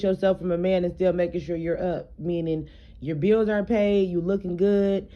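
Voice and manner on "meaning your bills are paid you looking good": ordinary delivery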